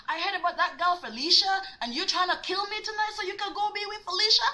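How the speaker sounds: high, indignant retold rant; worked-up mock interrogation; jealous accusation. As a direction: accuse him, pitched well up.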